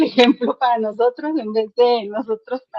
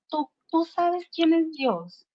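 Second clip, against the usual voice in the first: lightly voiced child, higher and slow; imitating son; 6 semitones higher, 4 dB quieter, slower than usual. Son asking the barber about God, imitated child's question. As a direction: ask it slowly and high, like a child. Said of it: "y le dijo".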